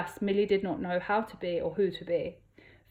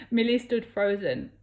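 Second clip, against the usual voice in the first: plain reading voice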